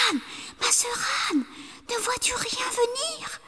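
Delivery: urgent whispered call; wife whispering to Anne in fear. A narrator's reading. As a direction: whisper up so he won't hear.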